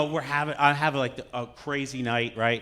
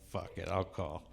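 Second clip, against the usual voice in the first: quiet mutter; 8 semitones lower, 12 dB quieter than usual. Retold or imitated. Hungover decision.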